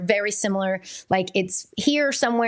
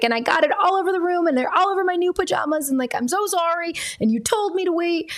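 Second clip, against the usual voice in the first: put-on high rushed child's voice owning up; a little girl blurting out what she did; guilty child confession; 9 semitones higher than usual. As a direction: daughter voiced confessing to mom about the paints. Daughter confessing paint mess.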